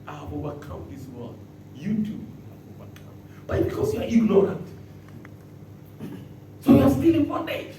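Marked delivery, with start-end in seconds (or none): 0.0-3.2 soft reassurance. Acted. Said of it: "That's what Jesus told the disciples".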